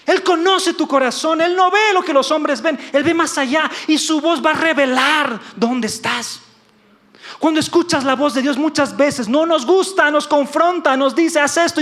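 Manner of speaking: loud, high, driving sermon; prédica a toda fuerza. Acted. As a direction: preach it hard, high and loud, pressing the congregation. Preaches at full force. (prédica enérgica, aguda y fuerte)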